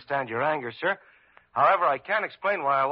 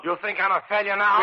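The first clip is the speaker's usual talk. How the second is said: louder, higher, stung and indignant; angry retort; 6 semitones higher, 6 dB louder than usual. Acted. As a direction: fire the question back at him. Intern quarrels with the hospital head.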